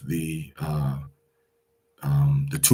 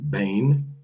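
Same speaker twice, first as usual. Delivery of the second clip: usual speaking voice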